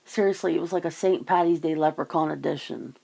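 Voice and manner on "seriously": ordinary delivery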